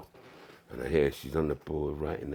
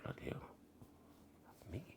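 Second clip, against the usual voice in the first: whisper; 20 dB quieter, faster than usual. Retold or imitated. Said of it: "and I'm like".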